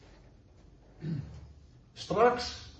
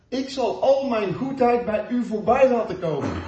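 louder and higher, with a laugh in it; emphatic repeat; 6 semitones higher, 6 dB louder than usual. Acted. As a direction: lift it up with joy the second time. Same promise repeated with emphasis. (nadrukkelijk herhaald, luider en hoger met een lach)

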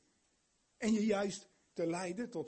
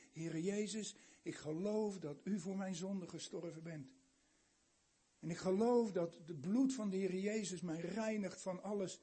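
softly spoken prayer; 3 semitones lower, 3 dB quieter than usual. Acted. Modelling a prayer.

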